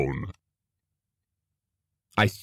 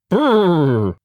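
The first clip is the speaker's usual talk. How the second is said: shivering brrr; 7 semitones higher, 4 dB louder than usual. Acted. Snow landed on face.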